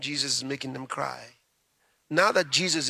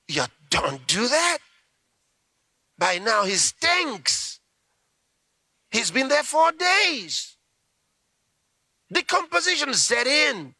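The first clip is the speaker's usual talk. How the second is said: higher and louder, slow and aghast; alarmed protest; 10 semitones higher, 4 dB louder, slower than usual. Acted. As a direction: recoil at the idea, protesting.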